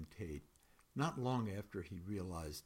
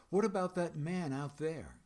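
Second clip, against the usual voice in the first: higher and louder, pointed question; raised protest; 7 semitones higher, 7 dB louder than usual. Acted. Narrator challenging the guard about the floating man, raised protest. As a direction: push back at him, voice lifting.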